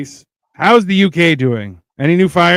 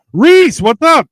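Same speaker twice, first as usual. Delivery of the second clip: loud, high shout-out; big, punchy, high hello; hearty greeting; 11 semitones higher, 8 dB louder, slower than usual. Real, not acted. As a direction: hail a friend joining the chat. Hailing a viewer who joined chat.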